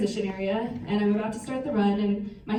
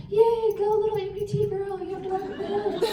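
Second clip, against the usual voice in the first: high, bright mock-cheer of passing spectators; 10 semitones higher than usual. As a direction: chirp it like a cheering stranger. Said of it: "people were like".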